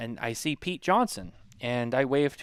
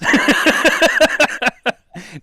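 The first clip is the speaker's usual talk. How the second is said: high, loud burst of laughter; 12 semitones higher, 11 dB louder than usual. Real, not acted. Laughing at his own airport story. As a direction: crack up at the memory.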